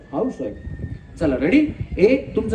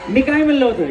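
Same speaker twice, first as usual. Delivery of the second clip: laughing tease; 5 semitones higher, 5 dB louder than usual. Real, not acted. Host teasing contestant who lost.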